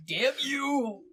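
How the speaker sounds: high, hammed-up cartoon curse; mock angry curse. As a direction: shake a fist like a cartoon villain. Hamming up the villain's curse.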